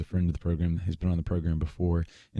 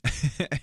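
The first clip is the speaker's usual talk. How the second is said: a short burst of real laughter, high; amused giggle breaking through; laughing; 8 semitones higher than usual. Real, not acted. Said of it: "this is so funny".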